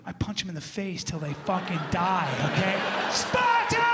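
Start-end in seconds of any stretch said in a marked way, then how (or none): 3.1-3.8 high, loud war shout; hammed-up Spartan yell; battle cry. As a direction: yell it like a warrior charging. Punching cow battle cry.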